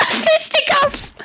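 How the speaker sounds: high laughing cry of the word; laughing shout. Real, not acted. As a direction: blurt it through laughter.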